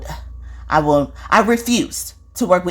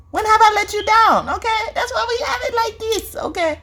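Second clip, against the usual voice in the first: much higher, airy voice, like a spirit talking through her; spirit's accent voice. Retold or imitated. Psychic passing on a spirit's message in its accent. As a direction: let the spirit speak high and light. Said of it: "this accent".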